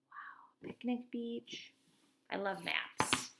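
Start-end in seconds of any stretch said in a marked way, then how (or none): 0.1-1.7 breathy hushed awe; whispered wow. Real, not acted. Teacher admiring the picnic beach on the map, whispered wow. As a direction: marvel under your breath.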